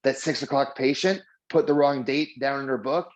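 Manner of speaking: ordinary delivery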